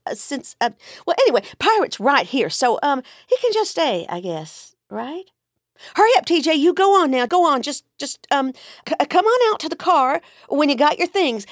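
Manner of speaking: a mother tripping over her words in embarrassment; mother caught at her son's house, nervous rambling; flustered rambling mother. Acted. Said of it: "She finished her rambling with a miserable sigh".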